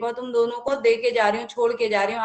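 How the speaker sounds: normal talking voice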